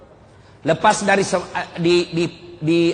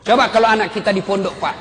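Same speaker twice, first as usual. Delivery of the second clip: normal talking voice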